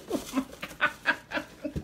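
high, slow words through laughter; helpless laughing. Real, not acted. Woman finding a donut box for mouse, laughing helplessly. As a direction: wheeze it out, laughing.

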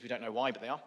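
usual speaking voice

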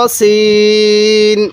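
তসিন with সিন drawn out very long; chanted তসিন, last syllable held; elongated recitation. Shown as a demonstration. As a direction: stretch the সিন in তসিন slowly. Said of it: "চার আলিফ টান দিব".

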